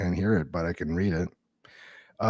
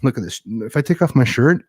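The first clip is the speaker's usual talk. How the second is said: normal talking voice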